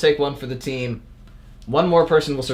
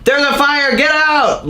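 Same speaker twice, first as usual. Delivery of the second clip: high frantic shout of alarm; yelling; 13 semitones higher, 3 dB louder, slower than usual. Retold or imitated. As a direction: yell it like the building's burning. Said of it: "someone just yelling".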